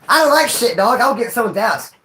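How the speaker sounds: normal talking voice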